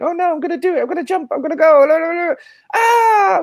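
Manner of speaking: very high, squeaky cartoon leaf panicking; panicky leaf voice. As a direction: squeal it like a tiny leaf about to jump. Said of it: "you hear this voice going".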